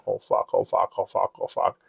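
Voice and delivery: low, soft repeated cursing under the breath; muttered dread. Retold or imitated. Audience member caught after an outburst, muttering in dread. Realising the audience heard his scream.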